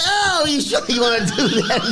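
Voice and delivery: high laughing Italian-American voice; Tony impression. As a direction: tease him like a wiseguy. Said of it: "Tony says".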